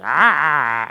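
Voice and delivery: loud imitated attack cry; mock crocodile lunging "aah"; crocodile attack roar. Crocodile going for a swimmer, loud imitated roar.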